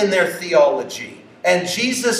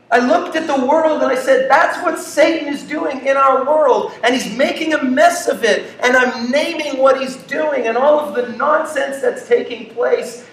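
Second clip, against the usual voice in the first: pitched-up, proud mock boast; boastful believer; 6 semitones higher than usual. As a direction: brag about calling Satan out. Believer boasting of casting out demons.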